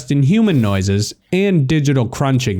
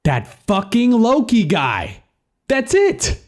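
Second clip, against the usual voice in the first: excited outburst; 10 semitones higher than usual. Acted. Sudden idea, acted exclamation.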